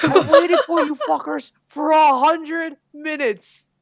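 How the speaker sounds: high, loud, drawn-out mock tirade; hammed-up screechy outrage, slowly stretched; imitated drunken yell. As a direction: holler it like a fed-up tipsy friend. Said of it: "And she yells".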